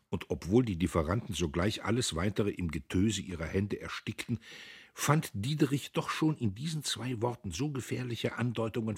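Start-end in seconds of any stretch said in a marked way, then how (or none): none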